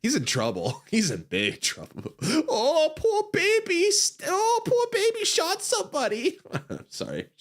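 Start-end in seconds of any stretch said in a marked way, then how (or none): 2.5-6.4 high mock-sympathetic coo; mock pity. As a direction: pretend to pity a toddler. Maya mocks Edgeworth again.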